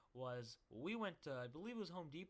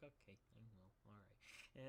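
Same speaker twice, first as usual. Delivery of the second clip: much softer, resigned okay; meek reply; 6 semitones lower, 15 dB quieter than usual. Retold or imitated. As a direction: give in quietly, sheepish. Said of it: "And I'm like".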